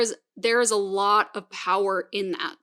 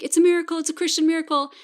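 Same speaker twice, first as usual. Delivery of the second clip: high, louder imitated celebration; mock jubilation; 8 semitones higher, 4 dB louder, much faster than usual. Mother and child in the car after recovery, jubilant. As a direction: proclaim it high and loud, playfully overjoyed.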